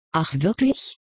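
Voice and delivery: gently doubtful question rising at the end; mildly unconvinced professor. A narrator's reading. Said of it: "zweifelte der Professor mild".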